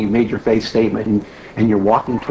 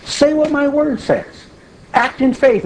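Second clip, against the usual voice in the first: booming divine rebuke, pitched up; God scolding; 12 semitones higher than usual. Retold or imitated. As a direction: scold them from heaven. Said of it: "And God's saying".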